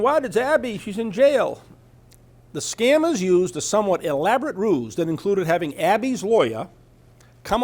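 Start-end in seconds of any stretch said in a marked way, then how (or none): 0.0-1.6 mock crying. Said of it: "She's crying".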